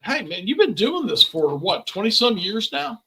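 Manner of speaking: ordinary talk, nothing marked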